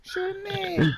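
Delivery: very high, soft squeaky cartoon voice; Mister Meeseeks voice. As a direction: chirp it like an eager cartoon helper. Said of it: "I'm also out here like".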